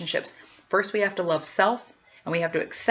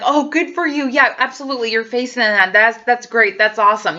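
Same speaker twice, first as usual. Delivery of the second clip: mock cheerful support; 4 semitones higher, 6 dB louder than usual. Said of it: "everybody's like".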